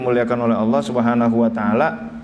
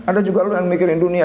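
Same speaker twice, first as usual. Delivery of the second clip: higher, huffy muttered comeback; indignant inner retort; 6 semitones higher than usual. Retold or imitated. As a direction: rattle off an offended retort in your head. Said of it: "dalam hati begitu".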